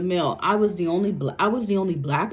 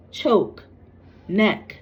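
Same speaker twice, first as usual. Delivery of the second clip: usual speaking voice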